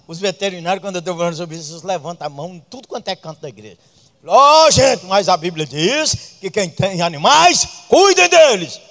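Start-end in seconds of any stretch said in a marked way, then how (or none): 4.3-8.8 preaching at full force. Acted. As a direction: thunder the Bible's command to the church. Preaching climax.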